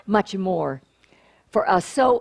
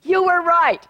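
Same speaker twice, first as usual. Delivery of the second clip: playful public admission; 8 semitones higher, 5 dB louder than usual. Acted. Publicly conceding to Reverend James.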